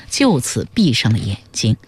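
usual speaking voice

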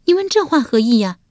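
high, fast, turning on him; sudden sharp offense. A narrator's reading. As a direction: snap back, face changing. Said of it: "文姜变脸说道". (文姜变脸，又快又尖地反问)

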